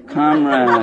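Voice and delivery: slow, solemn communist greeting; movie communist. Acted. Showing how Doctor Zhivago's communists talk, solemn parody. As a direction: intone it like a film Bolshevik. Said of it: "talk like this".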